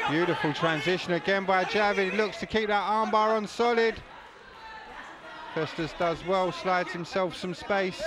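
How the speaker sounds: loud, high, thrilled play-by-play; excited commentary. Real, not acted. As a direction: call the armbar like it's the finish. Commentator peaks at armbar.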